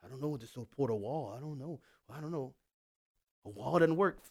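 mock the hesitant politicians in a low, soft unsure voice; dithering politician. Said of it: "who were".

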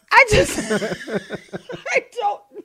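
high, loud, laughing speech; words squeezed out between laughs; helpless laughter. Real, not acted. Overcome by laughter after joke.

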